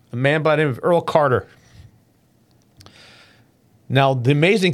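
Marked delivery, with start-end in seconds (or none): 0.1-1.5 a little laugh through tears; choked-up, recovering with a laugh; teary-eyed. Real, not acted. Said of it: "I'm getting a little teary-eyed".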